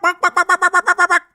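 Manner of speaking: high yapping bark, bark; mock barking. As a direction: yap like little dogs. Mocking critics as dogs.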